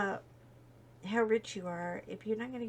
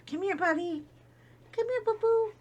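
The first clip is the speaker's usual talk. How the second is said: higher cooing call to the dog; 11 semitones higher than usual. Real, not acted. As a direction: coax the dog back, sweet and high. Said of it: "why do we always do baby talk to our dogs".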